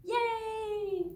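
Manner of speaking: high, gleeful cheer; excited cheer. Acted. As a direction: cheer like a happy kid. Cheering after the song.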